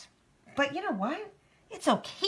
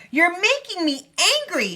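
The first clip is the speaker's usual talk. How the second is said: high, loud storybook outburst; furious; 9 semitones higher, 9 dB louder than usual. A narrator's reading. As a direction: burst out in acted anger. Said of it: "she jumped furiously said".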